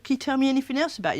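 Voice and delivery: put-on quick, bright question to a child; fast coaxing question. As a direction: rush the friendly question.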